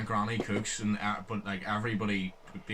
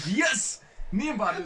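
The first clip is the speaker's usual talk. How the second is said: excited exclaim; 11 semitones higher, 4 dB louder, much slower than usual. Retold or imitated. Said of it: "And you're like".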